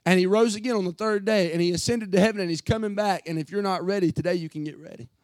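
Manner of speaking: high, loud, driving gospel appeal; impassioned preaching. Real, not acted. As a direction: preach it with rising force, easing at each close.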